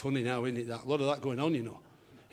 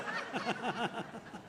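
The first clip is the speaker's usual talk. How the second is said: laughing; 7 semitones higher, 6 dB quieter than usual. Real, not acted. Comic breaks up laughing.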